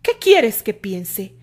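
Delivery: loud, slow, cross demand; Candy snaps back at Terry, annoyed. A narrator's reading. Candy answering Terry on horseback, slow and loud with annoyance.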